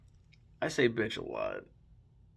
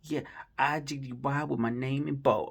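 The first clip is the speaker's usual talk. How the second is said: mock Iggy accent; 3 semitones higher than usual. Mocking [name]'s rap line, in a mock Australian accent. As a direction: parody her accent, mocking. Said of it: "then she's like".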